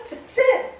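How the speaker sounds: high loud laugh. Real, not acted. Finishing the advice, laughing high and loud.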